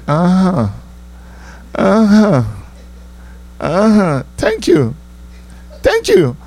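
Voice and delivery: high, mocking uh-huh and thank you; mock high brush-off. Comic mocking his date in a high voice. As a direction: brush her off in a high put-on voice.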